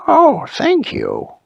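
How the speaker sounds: high, loud, slow happy giraffe; fixed voice. Acted. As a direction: sing out the thanks with a repaired voice. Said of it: "was just perfect".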